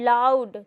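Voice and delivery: लाउड drawn out slowly, every sound shown; slow model of लाउड; demonstrating a word. Shown as a demonstration. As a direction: say लाउड slowly to show the tongue moving. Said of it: "मेरे बोलने का तरीका देखो".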